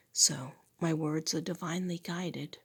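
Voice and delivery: husky half-whisper from a failing voice; a voice that keeps dropping to a whisper; real hoarse whisper, not meant sexy; losing voice. Real, not acted. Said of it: "I'm not trying to speak sexily".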